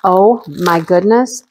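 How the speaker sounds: ordinary talk, nothing marked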